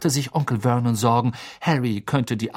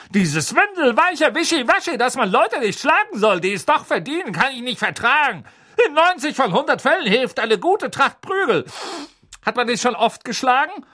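blustering aunt; 12 semitones higher than usual. Acted. Aunt preaching about beatings to Harry. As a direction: hold forth on beating. Magda voiced in story.

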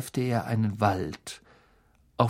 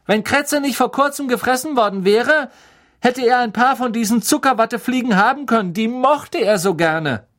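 high, loud, aggrieved proclamation; loud accusing announcement; 12 semitones higher, 7 dB louder than usual. A narrator's reading. Ron announces his grievance so Hermine hears, loud and accusing. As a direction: announce it pointedly for all to hear, pitched up.

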